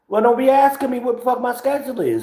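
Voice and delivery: high, mocking squeaky retort; mock nosy voice. Mocking back at nosy partners, high voice. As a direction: answer her nosiness in a high put-on voice.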